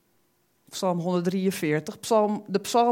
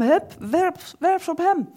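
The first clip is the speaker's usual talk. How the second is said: urging high call; 11 semitones higher, 4 dB louder than usual. Acted. Urging to cast worries on God.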